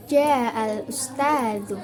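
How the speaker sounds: ja'al ustadzu slowly, low pitch level falling away. Shown as a demonstration. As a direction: draw out "ja'al ustadzu" and let the tone drop. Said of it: "nada rendah atau turun".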